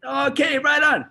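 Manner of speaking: high, eager retold yes; high-pitched, keen mimicked reply; gung-ho agreement. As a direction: jump on board with enthusiasm. Acting out the guy agreeing.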